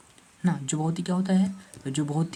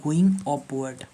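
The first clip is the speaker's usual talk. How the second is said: slow spelled-out; 3 dB louder, much slower than usual. Shown as a demonstration. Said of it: "चढ़ाई".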